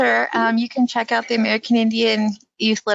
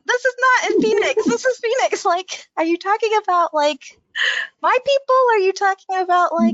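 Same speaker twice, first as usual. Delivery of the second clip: much higher, baffled questioning voice; incredulous reaction; 10 semitones higher than usual. Retold or imitated. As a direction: question it in high-pitched disbelief. Reader's incredulous reaction to books.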